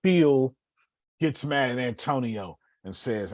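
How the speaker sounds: ordinary talk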